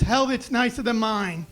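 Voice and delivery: ordinary delivery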